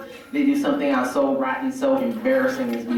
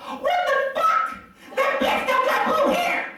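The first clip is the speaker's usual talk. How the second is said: very high, strained ranting; mock a furious fanboy at a screeching pitch; outraged fan rant; slower than usual. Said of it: "started psychoanalyzing the whole entire movie out loud".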